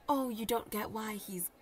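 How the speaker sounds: ordinary delivery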